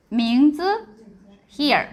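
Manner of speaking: usual speaking voice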